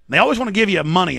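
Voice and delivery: ordinary talk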